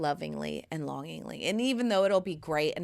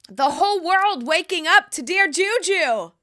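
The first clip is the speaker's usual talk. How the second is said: high and loud, like a hype announcer; loud triumphant hype; grand excited announcement; 11 semitones higher, 10 dB louder than usual. Pretend. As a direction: proclaim it to the world. Dreaming of worldwide fame.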